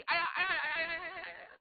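rapid high ow-ow cries; acted string of high pained yelps; yelping in pain. A narrator's reading. Li with wrist gripped by Xu, high yelping.

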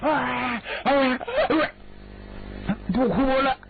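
put-on girl answering through tears, crying; tearful reply. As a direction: sob the words out coyly. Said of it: "姑娘正哭呢".

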